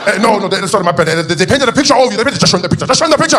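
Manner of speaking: high, loud, hurried protest; agitated, building to a high cry; flustered explaining. Acted. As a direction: fall over yourself explaining. Aide frantically explaining the painting.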